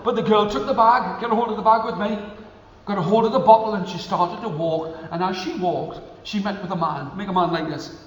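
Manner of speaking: usual speaking voice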